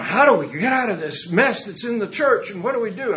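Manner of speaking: a hint of an anxious priest; worried clergy. Pastor voicing worried Episcopal clergy.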